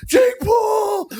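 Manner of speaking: very high, loud yelled name; mock fan shout. Fans calling out to him on the street, high mock yell. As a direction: holler it like a fan spotting him.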